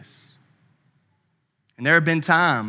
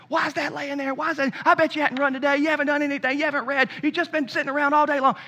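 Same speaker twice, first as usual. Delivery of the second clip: higher-pitched imitation of a dad going off; mock scolding; 11 semitones higher, faster than usual. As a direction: replay your own irritated nagging at the kids, pitched up. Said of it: "But I start just going off".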